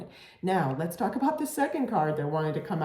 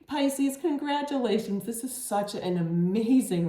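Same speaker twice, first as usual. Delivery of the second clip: excited; 6 semitones higher than usual. Real, not acted. Congratulating the viewer warmly.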